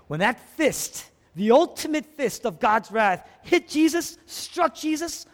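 high, forceful pulpit climax; full-force preaching. Real, not acted. Preacher describes God's wrath striking Jesus, full-force preaching. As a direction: land every word like a blow.

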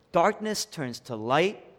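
usual speaking voice

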